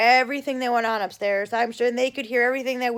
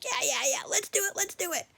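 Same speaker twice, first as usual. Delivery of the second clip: put-on softer eager kid, rising high; 7 semitones higher, 7 dB quieter than usual. Little brother all for the prank, eager. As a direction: imitate keen agreement, pitch climbing, kept soft. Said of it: "So he was all for it".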